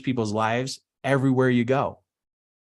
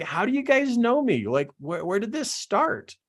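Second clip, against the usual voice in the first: retold bewilderment, pitched high; baffled question; 10 semitones higher than usual. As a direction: ask in high-voiced, puzzled amazement. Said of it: "And I'm like".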